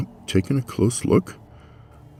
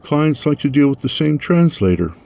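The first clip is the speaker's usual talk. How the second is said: breezy cover; 8 semitones higher, 3 dB louder than usual. Acted. Casual cover story.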